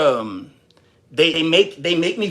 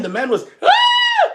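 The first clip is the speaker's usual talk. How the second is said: slow down, then burst into a shriek; fight scream; 5 dB louder, slower than usual. Pretend. Onlookers describing his fight scream, ending in a shriek. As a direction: quote ends in a high shrill yell. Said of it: "they're gonna be like".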